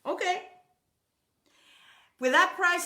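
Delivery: generous husband. Retold or imitated. Husband approving the car purchase, grandly. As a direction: give the go-ahead like a big spender. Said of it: "he says".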